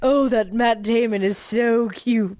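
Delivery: slow, put-on diva delivery; Meryl Streep. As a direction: savor the words as a film star being interviewed. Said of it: "I'm still Meryl Streep".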